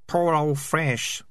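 slow, high, mournful rat voice. Acted. Rat mourning dead Fresh, slow and high. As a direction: drag it out in grief. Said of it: "He was a good rat".

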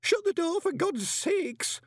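very high, loud plea drawn out slowly at the end; high-pitched, exasperated wail; panicked moan. A narrator's reading. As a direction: moan it in desperate alarm. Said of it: "moaned Horsefry".

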